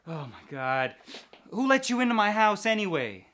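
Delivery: high, loud, straining against ropes; struggling against restraints. Real, not acted. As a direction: yell while fighting to get free. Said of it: "Untie me".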